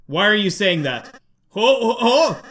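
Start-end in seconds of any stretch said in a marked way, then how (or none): 1.5-2.4 a hint of a baby Santa laugh; light jolly Santa chuckle. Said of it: "You sound like baby Santa Claus".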